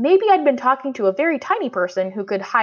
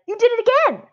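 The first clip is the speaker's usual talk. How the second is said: put-on much higher, excited little girl; little sister's voice; 4 dB louder, faster than usual. A narrator's reading. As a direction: gloat like a kid who caught you. Violet catching him out.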